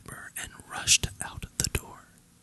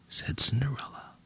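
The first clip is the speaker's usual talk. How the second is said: plain reading voice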